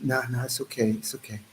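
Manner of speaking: very soft, low reassurance; calm. Acted. Acting out not reacting to provokers, calm and hushed. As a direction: murmur it, refusing to react. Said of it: "We gotta be calm".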